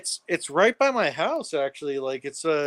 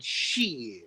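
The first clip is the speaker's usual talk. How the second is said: shit stretched very slowly and quietly; drawn-out shit; 5 semitones lower, 10 dB quieter than usual. Shown as a demonstration. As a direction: drag out shit, long and low. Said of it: "I don't think you heard what I said".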